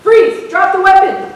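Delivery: loud, high police commands; officer bellowing freeze; cop shouting orders. Acted. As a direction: yell it at an armed man. Cop yelling again.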